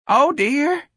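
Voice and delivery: very high, feeble character voice; feeble old woman. A narrator's reading. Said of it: "she said feebly".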